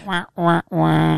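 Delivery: high mouthed "wah wah" trombone; sad trombone noise. Pretend. Podcaster mocking co-host's chip pun, sad trombone noise. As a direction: imitate a comic losing horn.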